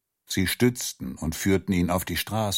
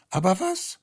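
much higher, puzzled; rising bewildered question; 13 semitones higher than usual. Acted. Guest at the table, bewildered, asks himself high. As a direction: ask it again, far higher and lost.

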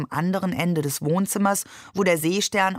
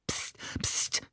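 soft, unvoiced warning hiss; hissed shush; 11 dB quieter than usual. A narrator's reading. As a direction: shush them urgently under your breath. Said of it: "zischte Ali".

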